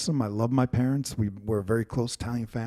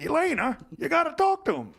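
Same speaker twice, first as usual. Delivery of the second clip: father insisting; 13 semitones higher than usual. Retold or imitated. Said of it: "And he's like".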